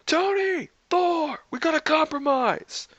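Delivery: very high-pitched call across the building; yelling. A narrator's reading. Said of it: "Darcy yelled".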